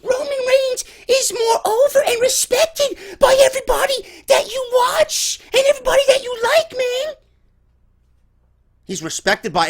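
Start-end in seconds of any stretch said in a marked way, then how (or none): 0.0-7.1 high whiny mocking goon voice; mock a dim fan, high and whiny. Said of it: "let me do the goon voice".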